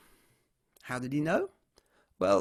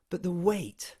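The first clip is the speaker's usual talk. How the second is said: ordinary delivery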